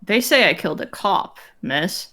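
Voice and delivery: lightly voiced proper, reserved man; measured, dignified character voice; lightly voiced formal bodyguard. Player voices Sosuke.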